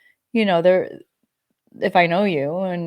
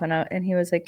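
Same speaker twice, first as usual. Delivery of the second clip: normal talking voice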